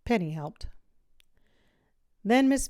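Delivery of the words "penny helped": ordinary delivery, nothing marked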